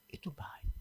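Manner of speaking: breathy hushed whisper of a sacred line; a whisper, as if sharing a holy secret. Acted. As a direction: let it fall to a whisper, full of awe. God's verdict, dropped to whisper.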